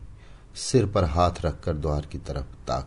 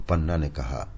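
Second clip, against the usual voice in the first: usual speaking voice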